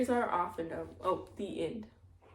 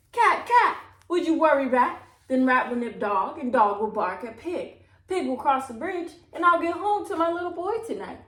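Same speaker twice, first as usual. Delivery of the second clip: pleading; 5 semitones higher, 6 dB louder than usual. A narrator's reading. Old woman pleading with the cat.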